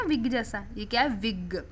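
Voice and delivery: ordinary talk, nothing marked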